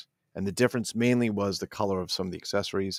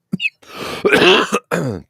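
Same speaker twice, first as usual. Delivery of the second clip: loud high laugh; real amused laugh, cracking up; laughing out loud at the names; burst of laughter; 11 semitones higher, 10 dB louder than usual. Real, not acted.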